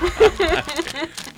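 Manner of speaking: laughing. Real, not acted. Risa ante el personaje.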